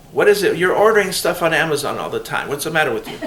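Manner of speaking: scolding mother. Retold or imitated. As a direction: play the suspicious mom scolding her daughter. Mother scolding about Amazon deliveries.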